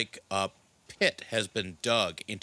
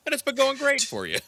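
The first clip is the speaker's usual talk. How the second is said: sarcastic; 3 dB louder, much faster than usual. Acted. DM sarcastic high jab.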